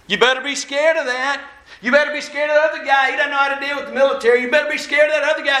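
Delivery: high-pitched, hammered-out mock warnings; fearmongering politician. Politicians warning voters in ads, urgent mock alarm. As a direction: imitate attack ads, speeding up as the warnings pile up. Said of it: "What was their message?".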